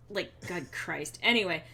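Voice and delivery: ordinary talk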